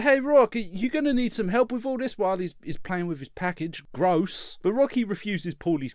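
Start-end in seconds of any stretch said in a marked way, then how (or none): 0.0-2.2 Paulie voice. Retold or imitated. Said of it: "Paulie's like".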